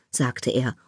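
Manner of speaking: ordinary delivery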